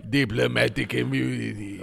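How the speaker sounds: low, clipped South African villain accent, imitated; mock the villain's South African accent; villain quote accent. Friends quoting the Lethal Weapon villain in his South African accent.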